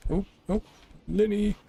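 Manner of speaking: high, delighted cry of the name; light up on spotting him; sudden happy call of his name; excited call. Real, not acted.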